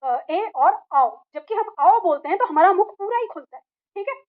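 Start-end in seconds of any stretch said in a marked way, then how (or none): 0.0-1.1 slow, drawn-out अ, ए and आओ, each vowel held; slow vowel listing. Shown as a demonstration. Teacher slowly sounding out the textbook's examples to students. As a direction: sound out अ, ए, आओ one by one, stretched. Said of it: "मतलब कि ये".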